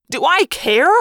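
high, loud retort; shouted, incredulous comeback; exasperated yell. A narrator's reading. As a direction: yell back in panicked impatience. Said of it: "Marco yelled".

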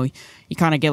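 ordinary delivery

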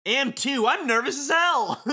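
high-pitched, hammed-up insistence; indignant protest. Beat insists to his friends he is nervous, indignant protest. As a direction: protest it, voice jumping way up. Acted retort of game character Beat.

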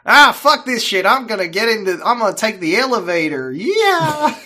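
put-on high, loud British-tinged Southern accent; botched Southern accent. Host translating the boy's elevator outburst, hammed-up Southern accent. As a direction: holler it in a badly faked Southern accent. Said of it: "me trying to do, like, a stereotypical Southern accent".

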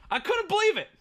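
high-pitched, giddy disbelief; gush, voice lifted high with excitement; real excitement. Real, not acted. Client telling of the new tattoo, real excitement. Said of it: "I'm so excited".

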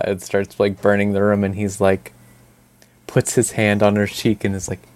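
ordinary delivery

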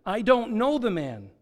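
insistent denial, read with force; sworn denial. A narrator's reading. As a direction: swear it firmly, voice lifted. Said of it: "he swore to them".

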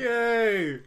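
high, loud "yay"; whooping little victory cheer; celebratory cheer. Acted. Mock cheer at making it.

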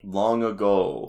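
slow ominous storybook voice; dramatic storyteller. Pretend. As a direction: drag it out like a legend. Echoing dramatic narration.

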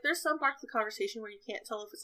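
normal talking voice

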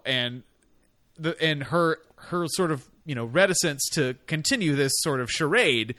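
ordinary talk

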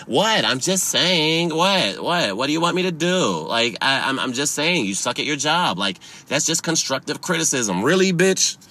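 high, quick sassy taunt; sarcastic needling; mock defiant sass. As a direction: play the smug customer. Mocks customer's defense.

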